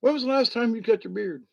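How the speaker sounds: lightly voiced friend teasing; mock Rob question. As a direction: toss off Rob's teasing question, quick and high. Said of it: "He'll probably go".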